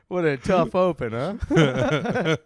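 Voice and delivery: loud, real laughter through the words; laughing. Real, not acted. Cracking up at the bit.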